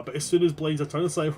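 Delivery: ordinary talk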